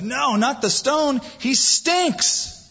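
high, slow comic protest; Martha objecting. Retold or imitated. Martha objecting to moving the stone, high and slow. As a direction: squeak out the objection in disgust. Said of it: "he goes".

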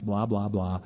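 usual speaking voice

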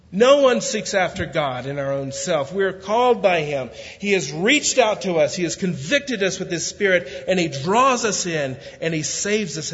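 full-voiced preaching. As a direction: preach it with conviction. Preaching builds to full force.

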